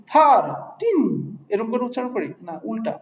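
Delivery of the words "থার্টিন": থার্টিন pitched higher and louder in a mock wrong reading; wrong stress demo. Shown as a demonstration. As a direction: show the wrong way to stress থার্টিন, high and loud. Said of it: "আর কখন কি এভাবে উচ্চারণ করি?".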